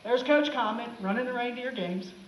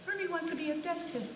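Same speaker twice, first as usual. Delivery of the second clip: higher, softer lightly voiced elf; Hermie's voice; 5 semitones higher, 8 dB quieter than usual. Lightly voiced elf Hermie explaining his dream, higher and softer. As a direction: say it the way Hermie talks, gently. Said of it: "That's the way they talk".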